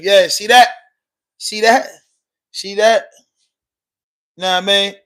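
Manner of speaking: loud, rising, triumphant jeer; gloating. Real, not acted. To a chat troll he just booted, a loud gloating jeer. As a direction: crow over it, pleased with yourself.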